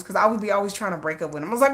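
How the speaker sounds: normal talking voice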